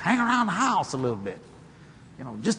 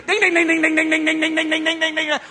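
high, rapid monotone dinging; loud ringing ding-ding on one high note; slot machine bells; 11 semitones higher, 5 dB louder than usual. Retold or imitated. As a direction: imitate a jackpot bell going off.

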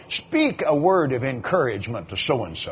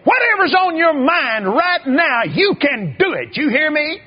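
preacher giving orders in a raised, high voice; voiced other preacher; 8 semitones higher, 4 dB louder than usual. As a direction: mimic the older preacher insisting. Voicing another preacher's instructions.

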